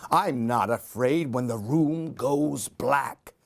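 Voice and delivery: ordinary delivery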